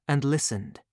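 ordinary delivery, nothing marked

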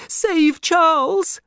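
high, loud, slow anguished cry; desperate plea. Acted. Lucy begs her father to save Charles, desperate cry. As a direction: beg for his life.

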